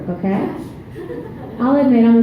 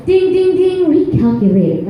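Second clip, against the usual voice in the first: high, loud singsong dings; GPS voice; 6 semitones higher, 4 dB louder than usual. Acted. Comic acting out her jealous GPS, mimicking GPS voice. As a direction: imitate a GPS chiming a reroute. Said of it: "this shit will go".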